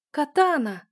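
high, loud delighted shriek; joyful squeal. A narrator's reading. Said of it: "с радостным визгом". (радостный визг)

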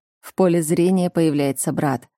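ordinary delivery, nothing marked

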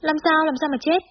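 high, startled questioning; alarmed question. A narrator's reading. Friend answering a panicked phone call, alarmed. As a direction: ask in sudden worry, pitched right up.

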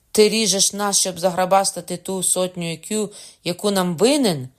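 loud, high indignant accusation; angry roar. A narrator's reading. Father accusing Cocona over the debt, roaring. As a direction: hurl the question out in fury. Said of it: "заревів Меркандон".

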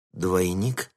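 usual speaking voice

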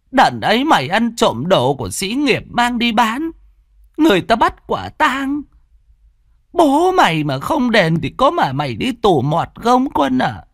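put-on high old woman's voice, scolding hard; an aged mother tearing into her son over his past; old mother, furious. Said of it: "Cụ Dậu tức tối". (giọng bà cụ cao, tức tối mắng con)

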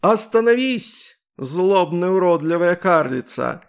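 high, loud, slow heroic declaration; grandiose heroic voice. A narrator's reading. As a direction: play the pompous superhero. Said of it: "воскликнула она самым своим пафосным голосом".

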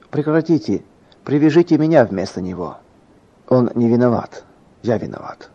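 ordinary delivery, nothing marked

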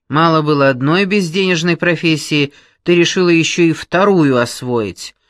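loud brash woman; brash boss. Acted. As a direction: boom at her like a brash boss. Brash boss interviews girl.

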